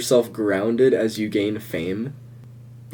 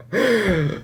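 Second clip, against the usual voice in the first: mock gasp; 10 semitones higher than usual. Reacting to teacher being mad.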